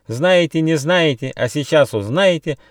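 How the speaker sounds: high, excited squawking taunt; bird cries. A narrator's reading. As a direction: call out like pestering birds. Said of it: "и с криками".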